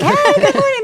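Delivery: very high, loud, chirpy hello; mock cheery greeting. Passer-by greeting a New Yorker, mock cheery. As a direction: sing out an exaggerated friendly good morning.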